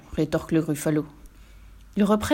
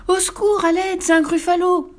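much higher, louder panic cry; terrified scream; 11 semitones higher, 5 dB louder than usual. A narrator's reading. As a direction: scream for help. Mouse meets the monster.

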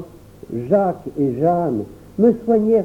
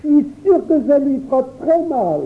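enfant effrayé; 11 semitones higher than usual. A narrator's reading. Frightened child fleeing.

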